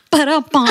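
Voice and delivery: high sung drum beat; dramatic music hum. Retold or imitated. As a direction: do the war music sound. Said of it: "He'd probably do the sound".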